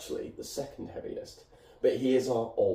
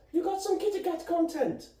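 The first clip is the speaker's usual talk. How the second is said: very high, thin kitty-talk squeak; squeaky cutesy voice. Pretend. Squeaky cutesy aside to viewers.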